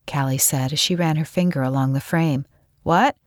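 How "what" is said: short, raised questioning call; lightly called-out question. A narrator's reading. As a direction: call it from the next room. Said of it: "Scott called".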